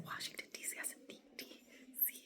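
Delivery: sheepish breathy whisper; whispered answer. Retold or imitated. Whispering her own guess to viewers. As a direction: whisper the wrong answer to herself.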